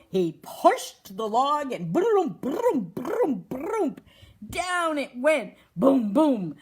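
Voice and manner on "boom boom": loud boom, boom; booming sound effect. Acted. Narrator booming out the log crashing downhill, loud. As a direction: bang out the crash for the kids.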